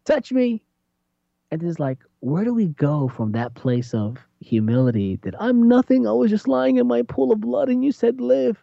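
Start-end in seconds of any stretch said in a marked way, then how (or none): 0.0-0.6 pleading psalmist. Retold or imitated. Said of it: "He's like". 5.4-8.6 pleading psalmist. Retold or imitated. Humble voice of psalmist.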